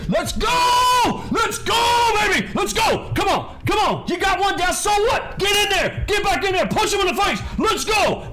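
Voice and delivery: full-out high-pitched hype shouting; Vinny yelling. Acted. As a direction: yell like a coach pumping up the trader. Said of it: "You got Vinny yelling in your ear".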